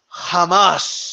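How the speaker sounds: "Jamás" drawn out with a very long hissed final s; Margarito. Retold or imitated. Showing how long the boxer said it, "Jamás" endlessly stretched. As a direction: hold the last s of "Jamás" absurdly long. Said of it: "for that long".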